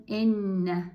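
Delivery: ان said "enna", opening on a short e; doubled N after zer. Shown as a demonstration. Teacher reading alef with zer and tashdid noon, ان as "enna". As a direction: hold the double n in ان: "en-na". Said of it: "الف ما زیر داره".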